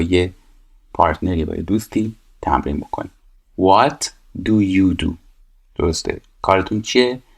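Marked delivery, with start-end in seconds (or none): 3.6-5.1 very slow and drawn out; slow teacher reading the question; slow read-aloud. Shown as a demonstration. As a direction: say each word slowly for the class. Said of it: "reading the exercise aloud".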